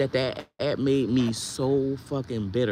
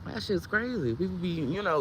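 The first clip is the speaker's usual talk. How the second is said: usual speaking voice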